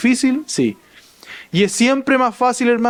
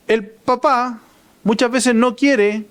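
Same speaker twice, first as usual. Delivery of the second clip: normal talking voice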